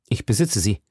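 a lightly voiced, secretive woman; Milady voiced. Milady answering softly.

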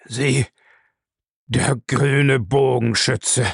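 very slow, drawn-out words; stunned, halting. A narrator's reading. Bellamy staring at the archer who condemned him, stunned and halting. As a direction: barely get it out, word by word. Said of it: "Weiter brachte er nichts hervor".

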